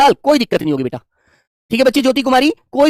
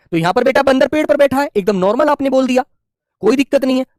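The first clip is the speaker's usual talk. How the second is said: usual speaking voice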